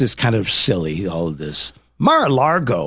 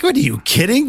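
incredulous; 8 semitones higher than usual. Retold or imitated. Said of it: "I'm like".

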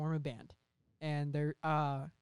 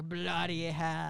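Hugo Weaving impression; much slower than usual. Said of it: "he's like".

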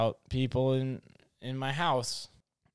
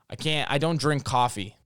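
ordinary delivery

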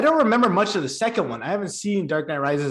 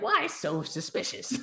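mock Joker; 2 semitones higher, 9 dB quieter than usual. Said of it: "He's like".

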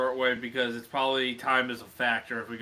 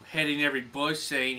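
ordinary talk, nothing marked

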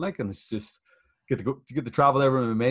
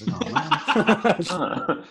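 laughing; 9 semitones higher, 5 dB louder, much slower than usual. Retold or imitated. Laughing at being told to be himself.

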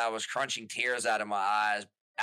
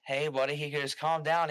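mock placating boss; 5 semitones higher than usual. Imitating the boss telling him to calm down, condescending. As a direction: talk down to him like a child.

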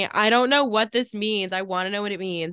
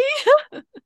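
high laugh; 7 dB louder than usual. Real, not acted. Laughing at her own accent bit.

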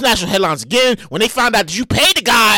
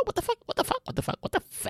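much softer, breathy repeated muttering; whispered disbelief; 9 semitones higher, 13 dB quieter than usual. Real, not acted. Host stunned by [name]'s announcement, muttering softly under his breath.

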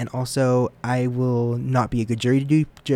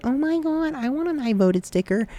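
put-on squealing high kid voice, much higher; excited little kid; 14 semitones higher than usual. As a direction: gush like a kid begging for a sticker. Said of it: "and I was like".

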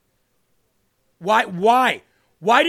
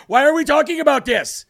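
heated rant; 4 semitones higher than usual. Real, not acted. Host ranting at viewers about topics the debate skipped. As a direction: build outrage with every why.

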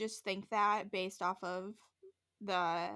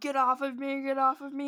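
loud, raised-pitch mock yell; retold yelling; 4 semitones higher, 8 dB louder than usual. Demonologist fighting off the spirit, mock yelling. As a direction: imitate someone shouting to be let go. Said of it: "just starts yelling".

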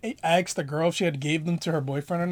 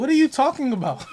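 higher-pitched, incredulous complaint; exasperated disbelief; 8 semitones higher, 3 dB louder than usual. Real, not acted. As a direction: tell off a clueless machine. Objects to Alexa's wrong answer.

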